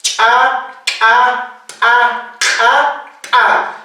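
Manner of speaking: "Cha, a, a, a, a" with popped ejective ch, k, p, q, t; reinforced ejective consonants. Shown as a demonstration. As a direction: pop each consonant, then a separate "a". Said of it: "las consonantes reforzadas". (consonantes reforzadas, con chasquido glotal)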